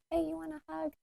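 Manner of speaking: like a gentle mother holding out her arms; soft, high, caring feminine voice; feminine nurturing. Retold or imitated. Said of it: "but, like, the feminine of".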